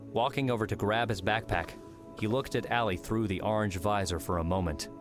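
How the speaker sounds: ordinary delivery, nothing marked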